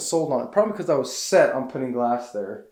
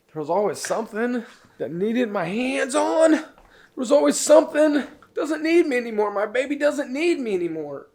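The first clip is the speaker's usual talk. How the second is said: crying; 9 semitones higher than usual. Real, not acted. Grieving the cabin like a grown child, crying. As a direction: voice breaking, much higher, sobbing. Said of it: "Stupid, why?".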